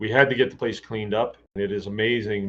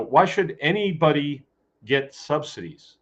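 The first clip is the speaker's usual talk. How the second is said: normal talking voice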